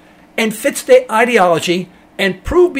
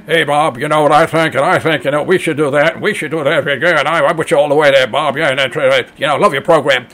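mock talk-radio fan gushing at the host, lowered voice; dumb caller; 6 semitones lower than usual. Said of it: "people calling in".

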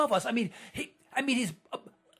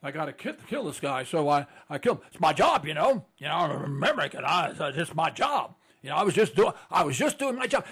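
low, mumbling, shrugging voice of a man following orders; mock soldier shrugging off murder; indifferent hired killer; 8 semitones lower than usual. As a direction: play a dull functionary who just did his job. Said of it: "And saying".

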